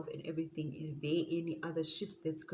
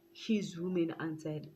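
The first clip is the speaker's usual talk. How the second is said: ordinary talk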